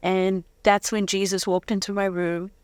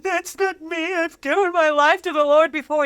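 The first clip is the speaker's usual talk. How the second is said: higher, tearful retelling of her protest; 6 semitones higher than usual. Retold or imitated. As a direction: voice of a girl crying in church, half-mocking. Retells crying protest in church.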